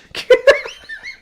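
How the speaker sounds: shrill mocking villain cackle; high cackle. Villain cackling at the hero's wound, high and mocking.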